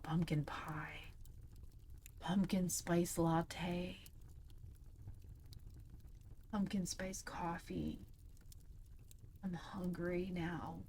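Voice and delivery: slow soft breathy whisper. Acted. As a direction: hush it dreamily. Joking whisper after reading.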